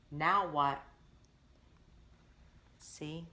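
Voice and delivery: ordinary delivery, nothing marked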